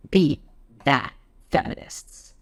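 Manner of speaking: high, punched first word, then slow and drawn out; smug taunt. Acted. Satirical supporter taunting feminists, smugly triumphant. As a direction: throw it down like a winning point.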